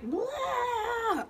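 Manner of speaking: put-on high, whiny kid noise. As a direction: imitate a fussy child. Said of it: "kids that are just like".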